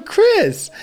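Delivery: put-on very high child's call; high-pitched kid voice; little kid. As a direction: imitate a small boy calling a name. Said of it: "He's going".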